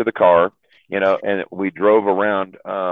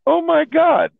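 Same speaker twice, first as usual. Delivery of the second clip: a much higher, startled oh my God; shocked; slower than usual. Retold or imitated. Both friends reacting to the couple nearby, mock shock.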